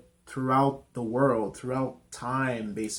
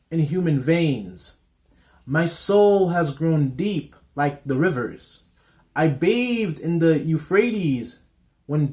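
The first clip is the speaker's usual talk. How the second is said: loud, slow declamation with gusto, pitch raised; powerful poem recitation; 4 semitones higher, 6 dB louder than usual. Real, not acted. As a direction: recite it grandly, swelling on key words and pausing after each line. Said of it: "the way I read it was to, to have some power, some gusto behind it".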